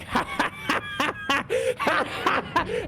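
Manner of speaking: high, long cackling fit; imitated laughter. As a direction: fake a prolonged high laugh. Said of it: "is it really that funny?".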